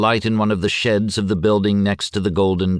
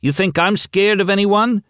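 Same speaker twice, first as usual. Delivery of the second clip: much higher and louder retort; snarled back; 14 semitones higher, 4 dB louder than usual. A narrator's reading. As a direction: bristle and snap back. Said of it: "Petrina snarled back".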